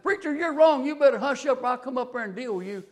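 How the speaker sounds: loud, high threat from the back pew; angry shout. Retold or imitated. Man in the back pew threatens the preacher, shouting.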